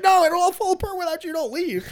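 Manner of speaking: high-pitched, rushed mock plea; imitating a desperate man begging someone to stay. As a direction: plead in a frantic squeak, hammed up for laughs. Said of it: "begging him".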